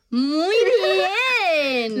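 high, long-drawn cheer; delighted congratulation. Real, not acted. Quiz host congratulating her friend's right answer, elated drawn-out cheer. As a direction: swoop up high and stretch the praise.

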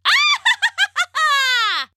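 high, loud wicked cackle; long theatrical witch laugh; witch's cackle. A narrator's reading. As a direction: cackle shrilly in triumph. Witch gloats over trapped prince.

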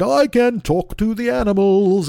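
high, slow, sing-song Dolittle voice; hammed-up gentle doctor crooning to animals. Dolittle greeting animals, slow high crooning. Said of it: "Doctor Dolittle was all like".

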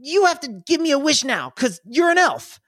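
put-on high child voice insisting on a wish; a little kid bargaining with an elf; demanding kid. GM as the kid demanding a wish, high insistent child voice. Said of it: "he stares at you and he goes".

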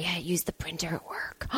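whispered confession; whispering. Real, not acted. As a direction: whisper it like a secret. Confessing aside.

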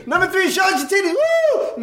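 very high, rapid emcee shout and whoop; hype man. Retold or imitated. Emcee to number three, hyped. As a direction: race through the call, then whoop. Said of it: "pumping up the crowd".

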